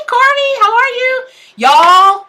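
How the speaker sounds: high, loud, gushing hello; light up greeting a friend; excited greeting. Real, not acted. Greeting a favorite viewer.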